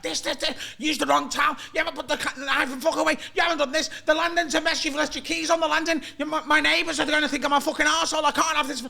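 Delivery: high, breathless list of grievances; mock screaming rant. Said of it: "he's just ranting at me and he's screaming".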